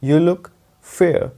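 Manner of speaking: very slow, each word spaced out; slow repeated model sentence; slow dictation. Shown as a demonstration. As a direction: spell the sentence out slowly for the class. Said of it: "इसका sentence ये है".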